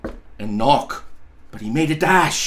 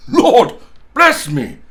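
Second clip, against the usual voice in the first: loud, slow, stunned exclamation; amazed old gentleman crying out, much louder; breathless astonishment; 6 semitones higher, 8 dB louder, slower than usual. A narrator's reading. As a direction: gasp it out as if winded. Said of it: "cried the gentleman, as if his breath were gone".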